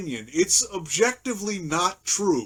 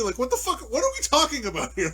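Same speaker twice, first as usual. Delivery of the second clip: ordinary talk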